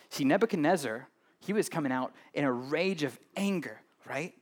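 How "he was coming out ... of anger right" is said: usual speaking voice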